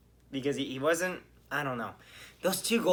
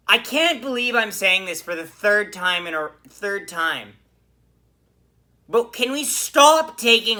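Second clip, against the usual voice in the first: exasperated pleading; 6 semitones higher, 11 dB louder than usual. Real, not acted. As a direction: beg the team to stop, at the end of your rope. Pleading about repeated late penalties.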